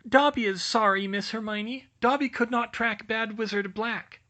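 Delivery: high, eager little elf; house-elf voice. Acted. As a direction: squeak it out like a nervous elf. Dobby reports back.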